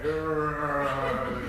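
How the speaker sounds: muffled growl through wrapped mouth; wrapped corpse groan; zombie groan. Retold or imitated. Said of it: "So it sounds like a zombie".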